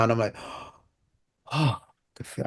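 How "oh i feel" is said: softly breathed-out "oh" of relief; retold relief. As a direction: let out a soft sigh, as if finally getting air. Relieved breath into oxygen mask.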